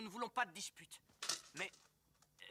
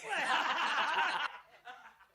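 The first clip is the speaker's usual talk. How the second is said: hearty laugh; 7 semitones higher, 7 dB louder than usual. Acted. Rebels laughing.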